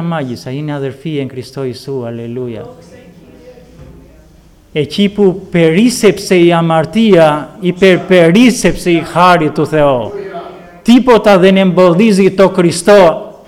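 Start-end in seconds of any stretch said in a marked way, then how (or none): 4.7-13.3 preaching. Acted. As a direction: thunder out the grace of God. Preaching at full force.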